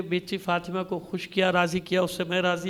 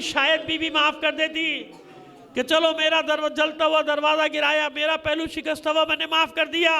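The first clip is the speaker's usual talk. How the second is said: climactic preaching; 8 semitones higher than usual. Real, not acted. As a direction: build to a soaring peak. Climax of the sermon.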